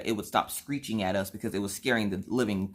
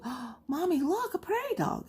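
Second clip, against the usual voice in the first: put-on much higher, a small girl's excited voice; high little girl voice. Daughter spotting a prairie dog, high little girl voice.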